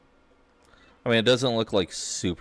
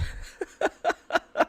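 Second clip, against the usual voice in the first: squealing laugh. Real, not acted. Laughing as sim hates clothes.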